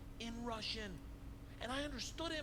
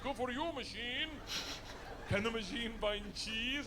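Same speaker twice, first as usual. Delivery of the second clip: Igor teasing; 8 semitones higher than usual. Retold or imitated. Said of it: "He's like".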